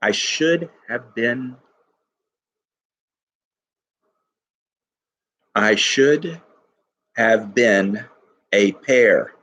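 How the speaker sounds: slow, beat by beat, "should" weighted; scanning the stresses. Shown as a demonstration. Teacher scans the meter aloud to test iambic, slow stressed beats. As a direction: tap out the meter on "I should have been". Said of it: "is trochaic ... or iambic".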